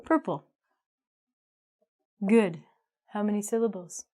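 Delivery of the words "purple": "purple" as PUR-pul, final E not sounded as ee; two-syllable pronunciation. Shown as a demonstration. Teacher showing students the exception, "purple" as pur-pul. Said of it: "This is an exception to our vowel counting rule".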